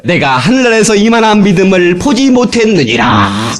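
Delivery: booming voice of the Lord, shouted in praise; the preacher voices the Lord praising such faith, shouting; the Lord's proclamation. Acted. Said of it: "주님이 뭐라고 하시거든?".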